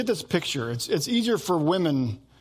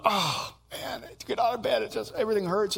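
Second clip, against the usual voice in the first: higher, softer mock groan about aching; softer, higher playful complaint about sore mornings; mock complaining; 4 semitones higher, 3 dB quieter than usual. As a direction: whine about getting out of bed. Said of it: "Sarah and I were complaining".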